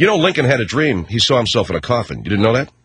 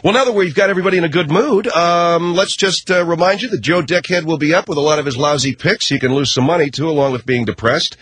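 radio promo announcer; 5 semitones higher than usual. Acted. Show promo announcer read.

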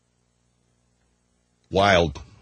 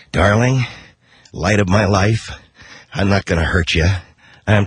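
a lower stab at Jack Nicholson; Nicholson impression; 9 semitones lower than usual. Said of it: "Do, do a Nicholson voice".

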